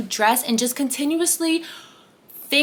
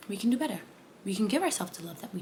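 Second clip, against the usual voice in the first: soft reassurance; 4 semitones lower, 13 dB quieter, faster than usual. Shown as a demonstration.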